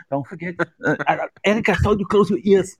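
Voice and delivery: normal talking voice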